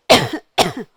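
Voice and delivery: two loud short coughs; acted cough. Puppet suddenly coughs in ventriloquist's hands, acted cough. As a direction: cough twice, sharply.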